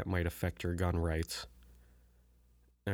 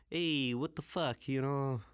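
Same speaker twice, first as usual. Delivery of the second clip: drawn-out, higher mock Italian protest; slow, hammed-up Italian-American complaint; Italian accent; 4 semitones higher, slower than usual. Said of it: "I type in Italian".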